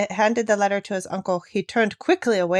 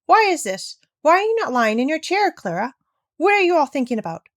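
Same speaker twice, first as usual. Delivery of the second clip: higher and louder, a shocked grandmother's scolding; shocked outcry; 6 semitones higher, 3 dB louder than usual. A narrator's reading. Grandmother shocked to find Clara out of her chair, crying out. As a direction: cry out in alarm, hurrying at the end. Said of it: "cried out in a shocked tone of voice".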